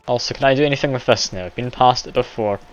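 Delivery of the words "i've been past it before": ordinary delivery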